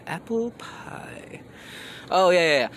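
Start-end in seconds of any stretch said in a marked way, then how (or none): 0.1-1.4 breathy high whisper. Pretend. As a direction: whisper it softly. Repeated, whispered.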